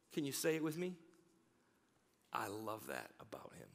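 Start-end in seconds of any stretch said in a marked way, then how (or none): 2.3-3.7 tender aside. Real, not acted.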